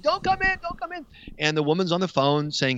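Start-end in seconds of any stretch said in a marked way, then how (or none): 0.0-1.1 high mock yell of don't come in; a high, lightly voiced cry of warning; retold yell. Woman on the phone yelling at the intruder, mock high yell. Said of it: "she's yelling".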